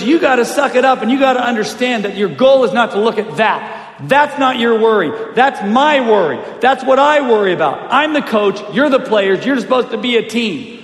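retold coach's lecture. Coach dad to every player down the line, forceful lecture. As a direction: lay down the law like a coach.

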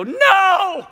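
drawn-out, high, loud mock scream of protest; horrified yell. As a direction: cry out in comic dismay. Reacts as cat jumps down.

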